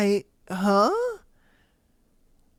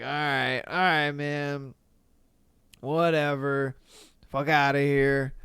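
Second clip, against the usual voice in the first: dismissive brush-off; 5 semitones lower, 3 dB quieter than usual. Retold or imitated. Dismissive husband.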